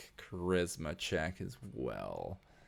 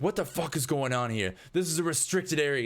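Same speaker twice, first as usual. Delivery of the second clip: higher-pitched, indignant demand; angry confrontation; 7 semitones higher than usual. Acted. Guard confronting two trespassers in a restricted area, indignant. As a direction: challenge intruders in outrage.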